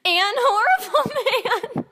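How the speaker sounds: high, slow words squeezed out through giggles; laughing through words. Real, not acted. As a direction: try to read but crack up. Cracking up while reading.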